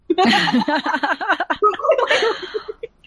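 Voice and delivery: a long high burst of laughter. Real, not acted. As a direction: crack up, high and loose. GM cracks up at the joke.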